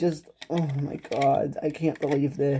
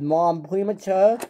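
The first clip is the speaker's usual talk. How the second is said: normal talking voice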